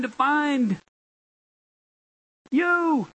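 high, drawn-out proclamation; full-force preaching. Real, not acted. Pastor proclaiming rescue to the congregation, full-force preaching. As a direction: preach it high, holding the key words.

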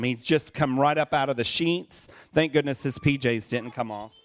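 ordinary talk, nothing marked

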